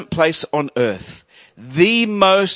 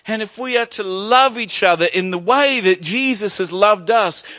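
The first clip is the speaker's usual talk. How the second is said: fervent preaching; 5 semitones higher than usual. Acted. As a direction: preach it out to the whole church. Preaching rises to full force.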